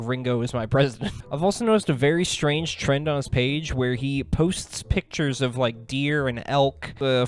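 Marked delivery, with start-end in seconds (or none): none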